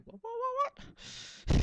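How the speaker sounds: high, dramatic squeal of confusion; hammy stage actor. Shown as a demonstration. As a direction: overact it like a stage ham. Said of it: "I'm gonna talk like an actor".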